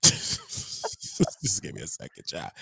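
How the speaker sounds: breathless laughter breaking through words; cracking up, barely getting words out; laughing. Real, not acted. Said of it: "Let me say the story without laughing".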